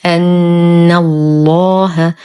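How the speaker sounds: slow recitation, long nasal hum on the doubled ن of أن, heavy ل in الله; full ghunnah. Shown as a demonstration. Teacher shows students the Name of Majesty, full ghunnah. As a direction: hold the نّ in أن الله, then a thick لّ. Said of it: "نشد النون بغنة أكمل ما تكون".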